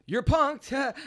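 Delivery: high, loud gotcha; spring the prank on them; triumphant reveal. Retold or imitated.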